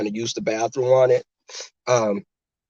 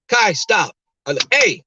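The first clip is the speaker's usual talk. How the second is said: raised, louder, sharp order; firm dog command; 6 semitones higher, 3 dB louder than usual. Retold or imitated. Owner stopping her badly behaved puppy, firm command. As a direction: call the dog to heel. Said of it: "I have to go into my deeper register".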